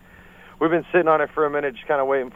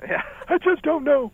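put-on old man voice. Joining the grandpa voice bit.

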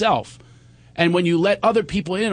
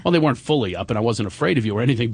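ordinary talk, nothing marked

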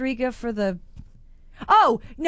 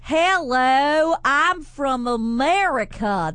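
mock American accent; 3 semitones higher, much slower than usual. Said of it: "That's what they think we sound like".

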